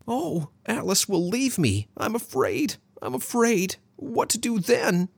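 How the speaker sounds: very high, hammed-up panic; exaggerated fear. A narrator's reading. Man rushing around acting out his fear, exaggerated fright. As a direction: fret in a high, frightened squeak. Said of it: "exaggerating his own fear".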